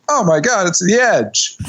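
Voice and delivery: high-pitched gleeful call-out; overenthusiastic. Retold or imitated. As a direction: imitate blurting out a joke with delight. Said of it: "I got overwhelmed with enthusiasm for the joke".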